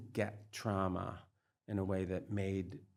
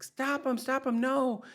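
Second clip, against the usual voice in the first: panicked pleading screams, much higher and louder, building to the end; terrified screaming; 10 dB louder than usual. Retold or imitated. Taken over by fear watching a movie, screaming in panic. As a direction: beg for someone to drag the attacker away. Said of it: "I'm, like, screaming like this".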